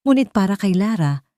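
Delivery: ordinary delivery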